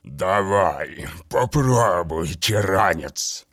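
low, slow, wet monster voice; gurgling daemon voice. A narrator's reading. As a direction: savour every word like a rotting daemon. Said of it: "Раздувшийся монстр поманил его".

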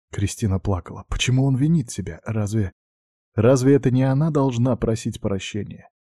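ordinary delivery

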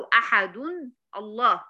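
أحد as "ahadun", pause, then الله; wrong unjoined demo. Shown as a demonstration. Teacher rejecting the other option, wrong unjoined demo. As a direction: separate "ahadun" from "Allah". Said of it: "ما ينفعش".

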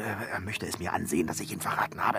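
frightened hushed urging; nervous hurry. Acted. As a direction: hurry away under the breath. Fleeing the master nervously.

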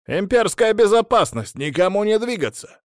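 high, loud command shout. Acted. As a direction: bark it like an officer raiding a room. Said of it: "раздался крик".

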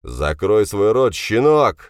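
slow, loud angry snarl; snarling retort. A narrator's reading. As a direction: growl the order, rising on the insult. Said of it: "зарычал в ответ Драгунов". (рычит в ответ, медленно и громко)

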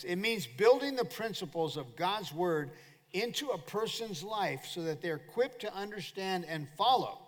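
usual speaking voice

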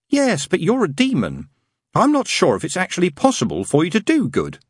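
a fussy angel stating a rule sincerely; prim angel. Acted. Angel telling the demon he can't do good, earnestly prim.

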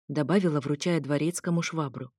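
ordinary delivery, nothing marked

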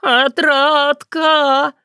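very long high yowl of a name; wailing call. A narrator's reading. Cat approaching through the forest, wailing. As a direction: howl it out, stretching each vowel. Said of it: "Некто завывающий приближался".

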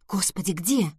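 plain reading voice

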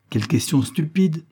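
réplique sèche et agacée, un peu plus aiguë; lâche-le avec une pointe d'agacement. A narrator's reading. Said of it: "répondit-elle avec agacement". (avec agacement)

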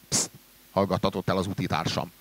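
usual speaking voice

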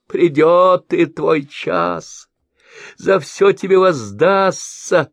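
high, loud, slow vengeful vow; ominous promise. A narrator's reading. Merchant promises mercenary his day will come. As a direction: promise his reckoning gravely. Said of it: "пообещал слепой".

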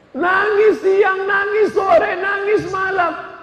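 high, slow wailing cry; hammed-up sobbing mother; mock wailing. Mother weeping twenty years ago. (tangisan ibu yang dilebih-lebihkan)

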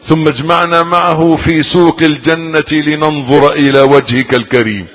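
fervent prayer. Acted. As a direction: plead with God, stretching each phrase. Preacher leads closing prayer.

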